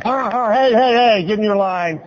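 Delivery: high, urgent calling out; hammed-up scolding of another motorist; mock indignant shout. Driver to someone getting close to the line, mock indignant shout.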